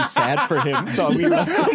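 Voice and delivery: real laughter breaking into the words; cracking up. Real, not acted. Teller reaching the punchline about the son, laughing. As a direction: lose it while telling it, pitch shooting up.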